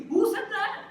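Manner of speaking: very high, loud incredulous cry; outraged challenge. Real, not acted. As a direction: shout the challenge in disbelief. Challenges the rule, louder this time.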